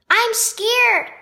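a small boy's mock whimper of fear. Little brother scared of the dark, whimpering. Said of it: "he whimpered".

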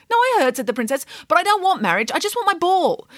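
high, impatient princess. Acted. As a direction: brush off the proposal, high.

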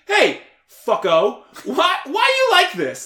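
loud, high imitation of hectoring adults; yelling. Guest imitating adults yelling at him as a kid, loud hectoring. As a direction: bellow it as a mock accusation. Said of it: "you all yell at me every day".

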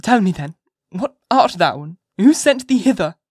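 high, loud questioning mother; half-playful demand. A narrator's reading. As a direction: press the child, half in play. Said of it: "half playfully".

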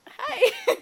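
put-on very high, loud British-accented hi; fake British accent. Woman shows what she resisted saying, loud fake British accent. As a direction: squeal a hammed-up British greeting. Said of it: "do a fake British accent".

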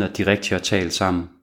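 plain reading voice

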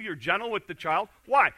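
usual speaking voice

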